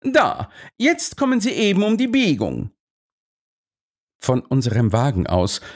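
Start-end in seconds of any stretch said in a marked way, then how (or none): 0.0-2.7 high, excited call; sudden exclamation. A narrator's reading. Holmes spots the horses at the bend, calling out excitedly. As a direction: cry out as the action comes into view. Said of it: "rief er plötzlich".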